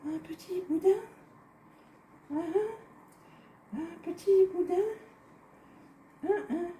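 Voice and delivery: high playful sing-song. Pretend. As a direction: chant it lightly, childlike. Playful repeat while rolling dough.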